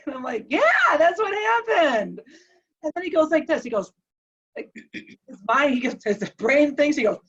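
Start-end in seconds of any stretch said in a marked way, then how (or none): 0.5-2.2 higher, eager exclamation; excited agreement. Retold or imitated. Wife confirms the patient's memory, excited. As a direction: act out an emphatic, delighted yes.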